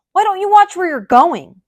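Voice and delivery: loud, high irritated shout; grumpy yell. A narrator's reading. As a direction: snap at a passerby. Grumpy grape yelling.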